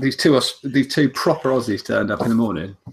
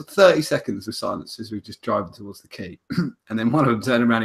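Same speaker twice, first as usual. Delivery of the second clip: ordinary delivery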